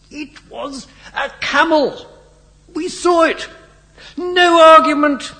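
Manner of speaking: loud, high-pitched spluttering fury; outraged rant. A narrator's reading. Irate Major reports the holes to the pilots, furious shouting.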